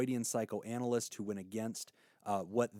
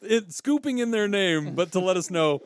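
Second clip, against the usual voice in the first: laughing; 4 semitones higher, 7 dB louder than usual. Real, not acted. Amused reading of a listener's note.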